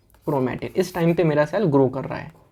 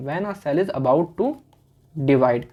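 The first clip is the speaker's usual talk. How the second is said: slow dictation; much slower than usual. Shown as a demonstration. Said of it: "slow dictation repeat".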